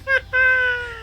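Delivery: high cackling villain laugh; maniacal laugh. Acted. Masked arsonist on the roof, cackling maniacally. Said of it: "He laughs maniacally".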